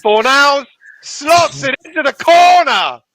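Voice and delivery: slow, loud, high goal call; excited commentary shout. Real, not acted. Calling the goal live.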